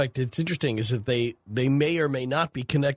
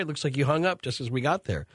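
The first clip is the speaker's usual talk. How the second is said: ordinary delivery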